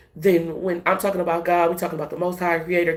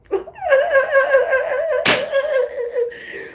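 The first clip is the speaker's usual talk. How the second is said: high cackle. Real, not acted. Laughing at a hater who thinks about her, high cackle. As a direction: burst out cackling.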